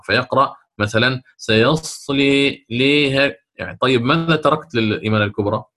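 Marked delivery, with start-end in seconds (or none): 1.4-3.3 "سيصلي" recited slowly with a full leaning to i, too much; deliberately wrong over-tilted recitation; wrong, over-leaned vowel. Shown as a demonstration. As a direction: mistaken reading with full imala instead of a light one. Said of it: "فقد يقع في الإمالة الكبرى".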